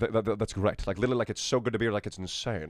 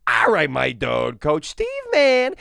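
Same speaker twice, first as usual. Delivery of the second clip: much higher, slow, loud; Coach Steve voice; 4 semitones higher, 8 dB louder, slower than usual. Retold or imitated. Coach Steve greeting his dog, high and slow. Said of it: "Big Mouth".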